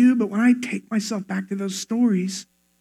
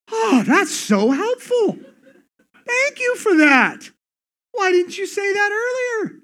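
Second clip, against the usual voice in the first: sarcastic gushing; 11 semitones higher than usual. Acted. Upset person answering "calm down", sarcastic gushing. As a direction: gush in an exaggerated high voice.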